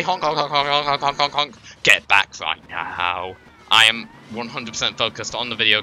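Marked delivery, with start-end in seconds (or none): 0.0-1.5 silly honking. Pretend. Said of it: "I'm honking anyway".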